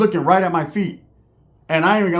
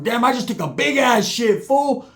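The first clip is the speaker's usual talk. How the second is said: higher, cocky homeboy voice; bragging homeboy; 5 semitones higher than usual. Retold or imitated. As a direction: brag about it in a raised voice. Homeboy leaving the bathroom.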